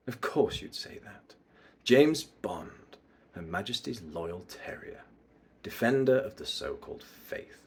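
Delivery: soft, near-whispered villain disdain; hushed menace with long pauses; mysterious bad guy. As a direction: dismiss an old rival under your breath. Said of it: "bad guy mysterious vibes".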